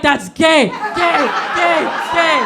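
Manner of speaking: loud, sing-song jeering chant; mock taunting shout. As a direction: barge in jeering like a bully. Bursting in, mocking.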